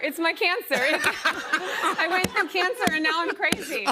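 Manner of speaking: high-pitched, laughing through the words; laughing confession. Real, not acted. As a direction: make a joking excuse while cracking up. Joking excuse, laughing along.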